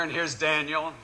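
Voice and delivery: ordinary talk, nothing marked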